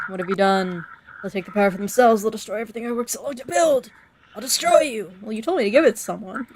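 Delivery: hint of a betrayed old man raging; angry islander. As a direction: read the villager's rant with some heat. Voices game character dialogue.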